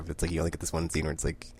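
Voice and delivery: ordinary delivery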